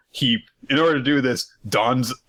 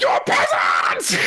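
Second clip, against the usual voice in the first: manic host; 3 semitones lower, much slower than usual. Acted. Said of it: "manic, you know, dragging on the".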